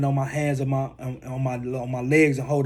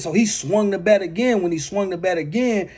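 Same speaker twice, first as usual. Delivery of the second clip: usual speaking voice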